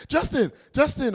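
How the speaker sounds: high-pitched repeated name call; calling for attention. Acted. People calling someone who ignores them, high insistent call. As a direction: call to someone wearing headphones.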